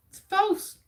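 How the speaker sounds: high, loud "false" called out; spring the answer like a game-show host; dramatic reveal. Real, not acted.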